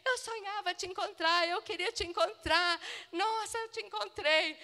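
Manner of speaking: high, delighted gushing; retelling a stranger bursting with happiness; the friend thrilled to finally meet her; overflowing with joy. Retold or imitated. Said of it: "transbordava de alegria".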